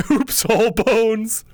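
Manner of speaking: high, giggly joke delivery; laughing. Real, not acted. Streamer riffing on bone-in nuggets, laughing and high-pitched. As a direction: crack up while blurting it out high. Cracking up at his own joke.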